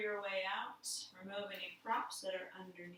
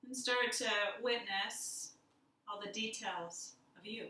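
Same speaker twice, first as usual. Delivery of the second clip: guided meditation. Acted. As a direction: lead them inward gently, letting silence sit. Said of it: "we'll do a little guided meditation".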